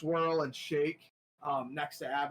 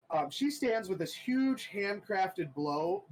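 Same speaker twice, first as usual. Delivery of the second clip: ordinary talk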